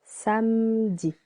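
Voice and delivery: Samedi with the first syllable sa held a little longer, slow. Shown as a demonstration. Teacher showing beginners the longer first syllable, slowly. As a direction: lean on the sa of Samedi. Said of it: "The first one is a little longer".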